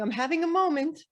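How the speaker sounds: hammed-up outburst; mock drama. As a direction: announce it loudly like a small crisis. Acting out announcing a personal moment.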